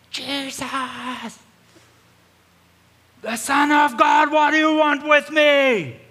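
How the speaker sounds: very high, drawn-out full-force shout; blood-curdling scream. Retold or imitated. Possessed man screaming at Jesus from the caves, blood-curdling scream. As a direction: scream it slowly across the cliffs, high and loud. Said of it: "we hear this blood-curdling scream".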